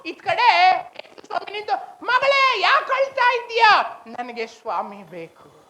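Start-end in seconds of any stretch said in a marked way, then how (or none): 2.0-4.0 high, raised call to a daughter; acted fatherly question, high-voiced; concerned calling. Lord calls weeping daughter. 4.1-5.6 low, soft, slow reply; acted soft plea, drawn out; plaintive longing. Weeping Mary answers, longing.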